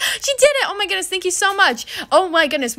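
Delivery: high-pitched burst of delight; real excited gushing; thrilled. Real, not acted. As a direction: squeal it out, overjoyed and grateful. Trade she wanted is accepted.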